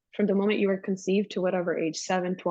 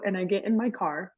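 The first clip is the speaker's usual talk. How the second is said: ordinary delivery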